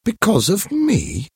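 high surprised question; startled, as if accused. Acted. To Mathilde's accusation, high surprised disbelief.

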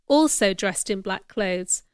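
plain reading voice

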